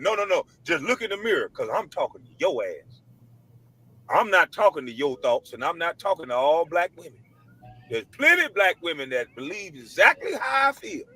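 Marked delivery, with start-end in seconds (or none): none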